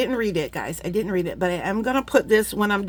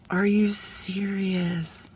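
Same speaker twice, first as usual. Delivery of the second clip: slow, breathless whisper of disbelief; awed whisper; much slower than usual. Real, not acted. Seeing tie-dyed beads, whispering in disbelief. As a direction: whisper it, amazed.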